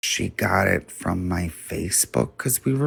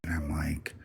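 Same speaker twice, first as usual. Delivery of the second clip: hushed; 9 dB quieter than usual. Retold or imitated. Confession told in hushed tones.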